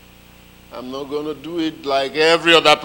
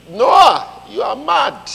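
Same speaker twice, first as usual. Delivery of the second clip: high, loud accusing shout; mocking shout; 11 semitones higher, 9 dB louder, much slower than usual. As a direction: yell it at him. People call Noah mad.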